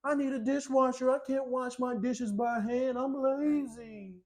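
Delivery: high, whiny put-on viewer voice; mock complaining commenter. Viewer begging Mister Tiny for a dishwasher, mock whine. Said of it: "before any of you go to the comments and say".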